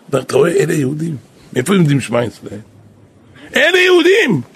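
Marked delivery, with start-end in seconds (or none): 3.6-4.4 high, loud exclamation; triumphant cry. Retold or imitated. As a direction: proclaim it in triumph. (קריאת ניצחון רמה)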